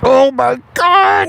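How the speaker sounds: slow, high mock cry; hammed-up porn outburst; porn performer. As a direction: wail in fake ecstasy. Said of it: "while pornography is playing".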